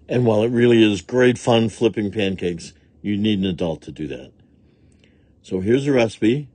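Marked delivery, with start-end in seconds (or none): none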